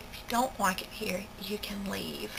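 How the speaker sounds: unvoiced whispered retort; mom's whispered jab out of earshot. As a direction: hiss it so she can't hear.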